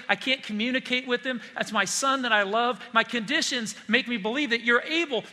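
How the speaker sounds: a desperate father crying out for his son; anguished father. Acted. Father's anguished plea voiced.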